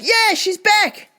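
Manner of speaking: high, drawn-out mock cheer; gleeful reenacted cheer; retold elation. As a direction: celebrate it with a high, stretched-out yeah. Retold joy at her return.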